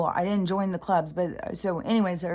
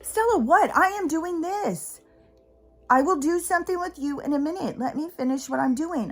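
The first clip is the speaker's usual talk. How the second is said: exasperated scolding; 9 semitones higher than usual. Real, not acted. Owner snapping at whining dog Stella, exasperated. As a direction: cut the dog off, then plead for a minute.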